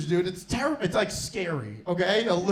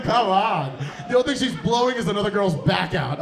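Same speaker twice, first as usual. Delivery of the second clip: ordinary talk, nothing marked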